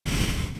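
soft, breathy sigh of reluctance; weary sigh. Acted. Busy boss to clingy lover, weary sigh. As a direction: let out a short, put-upon sigh.

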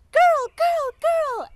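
put-on a little kid insisting loudly on girl; tiny child correcting the grown-ups; toddler insisting. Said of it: "And Jam said".

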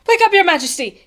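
high, loud cry of alarm; urgent shout. A narrator's reading. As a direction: shout to wake the king. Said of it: "he shouted".

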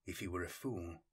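ordinary delivery

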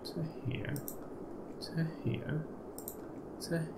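soft concentrated whisper. Real, not acted. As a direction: whisper while focusing. Drawing line carefully.

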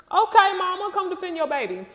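high, loud hype-up; hyped cheering. Real, not acted. Host cheers on a parent defending their baby, hyped.

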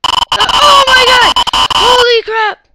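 loud high terrified shout; terrified scream. Real, not acted. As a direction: scream in terror. Shouting in terror.

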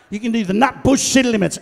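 ordinary talk

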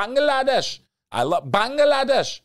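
Bangladesh in a high, loud, sing-song lilt falling at the end; savoring the name. Shown as a demonstration. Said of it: "I love saying that word".

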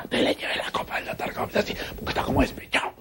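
soft unvoiced aside; whispered gossip. Real, not acted. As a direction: whisper it like a secret. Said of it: "susurra el chisme". (susurro confidencial sobre el doctor despechado)

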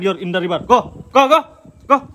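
ordinary talk

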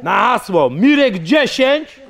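high, slow, drawn-out ad plug; sponsor ad read. Acted. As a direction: sell the sponsor code like a hyped announcer. Sponsor shout-out mid interview.

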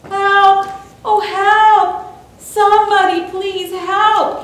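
high, loud drawn-out cries; screamed plea for help. A narrator's reading. Boy pinned to the track begs for rescue, high desperate scream. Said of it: "he screamed".

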